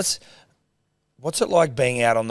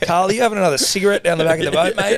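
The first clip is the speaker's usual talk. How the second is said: higher and louder, calling down the boat; mock boss yelling; 9 semitones higher, 5 dB louder than usual. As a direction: tell off a slacking celebrity crewman, half joking. Joining the mock boss tirade.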